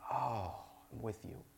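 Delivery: soft, tender voice of comfort; a gentle hush of shared grief; softly voicing a weeping Jesus. Jesus weeping over Jerusalem.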